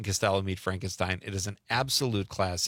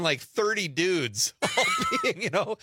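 high, drawn-out words through laughter; laughing; 8 semitones higher, slower than usual. Real, not acted. Host recalling a childhood sight at the stadium, laughing. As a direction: laugh your way through the memory.

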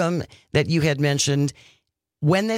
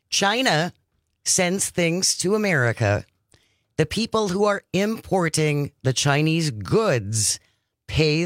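slow, deliberate spelling-out with pauses; real slow. Real, not acted. Host clarifying for listeners who pays tariffs, real slowly. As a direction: lay it out slowly, phrase by phrase. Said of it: "say this real slowly".

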